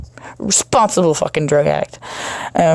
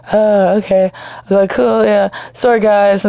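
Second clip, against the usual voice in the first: sheepish backpedal; 3 semitones higher than usual. Retold or imitated. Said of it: "Totally backpedaling".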